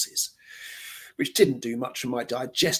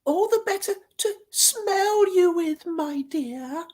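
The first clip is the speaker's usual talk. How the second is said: fake granny voice; slower than usual. Wolf faking granny.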